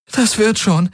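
high, choked-back reassurance; suppressing a sob. A narrator's reading. Kirai comforting the wounded man, suppressing a sob. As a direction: comfort someone while holding back tears. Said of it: "unterdrückte ein Schluchzen".

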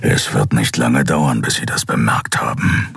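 growl. A narrator's reading. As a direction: growl it out low and unhurried. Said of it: "knurrte Keru". (tief und langsam geknurrt)

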